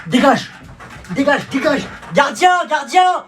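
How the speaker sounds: high yelling for help; panicked shout for the guard. Acted.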